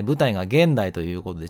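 ordinary talk

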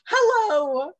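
high, loud, slow gushing hello; delighted squeal. Retold or imitated. Girlfriend meeting his grandparents, squealing with delight. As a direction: squeal with joy at the greeting. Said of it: "And I was like".

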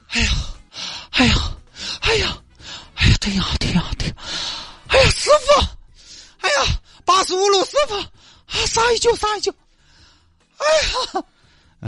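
high moaning cries to the bus driver; high whimpering woman's voice; groaning woman. Acted. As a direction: stagger and wail for the bus to stop. Li stumbling after bus, moaning.

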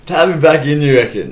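loud, mocking disbelief; incredulous. Player mocking his teammate's netball advice, loud incredulous retort. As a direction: throw his words back at him, loud. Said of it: "I was like".

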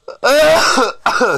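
loud harsh cough; coughing fit. Real, not acted. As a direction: cough hard, fighting for breath. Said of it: "Can't breathe".

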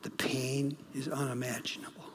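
low, soft quote of a grieving father; quietly quoting the grieving dad; quiet retelling. Retold or imitated. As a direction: say it softly, just reporting. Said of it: "He says".

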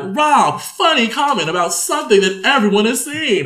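a hint of hammed-up setup voice; imitated chatty prompt. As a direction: lightly act out pitching a joke to a co-host. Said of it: "we'll be like".

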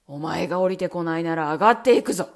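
low, loud, fast villain threat; threatening shout. Acted. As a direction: threaten at the top of his voice. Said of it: "と青ひげは叫びました".

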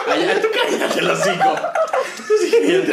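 entre carcajadas, más agudo; riendo. Real, not acted. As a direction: lo dice muerto de risa. Riendo tras la broma. (regañando al amigo entre risas)

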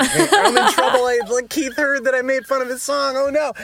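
high, laughing mock panic. Young musician fearing he's caught, laughing mock panic. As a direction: squeak it out, half-laughing, like you're busted.

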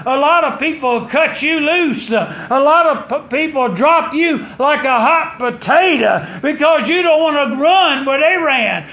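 high, loud, driving preacher cadence; preaching at full force. Acted. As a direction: preach it out, pushing each line.

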